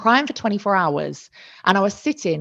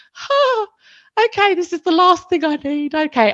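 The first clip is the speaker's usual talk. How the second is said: high, strained voice reliving the shock; acted-out overwhelmed squeak; mock panicked reaction; 10 semitones higher than usual. Mum-to-be reliving the bad phone news, panicked and high.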